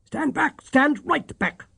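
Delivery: high, sharp order; sharp imperious command. A narrator's reading. Feverish Holmes ordering Watson away, sharp and imperious. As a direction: bark him back from the bed. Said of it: "with the sharp imperiousness".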